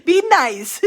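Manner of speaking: a giggled, teasing be nice; laughing. Real, not acted. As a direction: squeeze the words through laughter.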